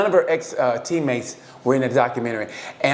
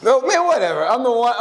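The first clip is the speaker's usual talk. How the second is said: high, louder cocky boast; mock bragging; 9 semitones higher, 4 dB louder than usual. Teasing his friend that he's the one, high cocky boast. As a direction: brag like you're the prize.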